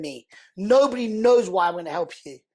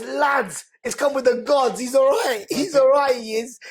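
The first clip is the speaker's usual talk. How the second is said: raised, louder call to the lads, half shouted; retold loud vouching; 6 semitones higher, 4 dB louder than usual. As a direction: vouch for him loudly, calling out to the group. Baz vouching for him to lads.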